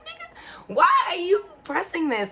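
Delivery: high, incredulous outburst; exasperated squeal. Retold or imitated. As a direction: shriek it in disbelief.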